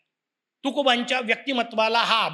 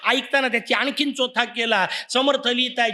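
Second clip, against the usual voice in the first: ordinary talk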